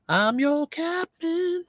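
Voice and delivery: high, slow put-on captain voice; drawn-out silly pilot announcement. Mock announcement after laughing.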